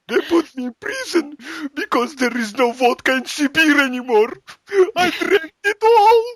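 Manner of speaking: very high hammed-up wail; mock crying. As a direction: sob out a tragic confession, overplayed, peaking loud at the end. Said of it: "he starts crying about it".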